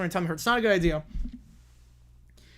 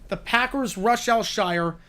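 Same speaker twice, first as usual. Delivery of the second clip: normal talking voice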